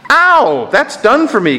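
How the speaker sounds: high pained yelp, then gasped words; gasped cry. A narrator's reading. Ghost as the lizard is torn away, high pained gasp. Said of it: "gasped the ghost".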